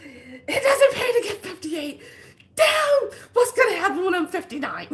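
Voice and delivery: high, loud half-crying wail; mock wail. Crying out on the table, mock wailing. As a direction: wail about her age as a joke. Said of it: "I was just like".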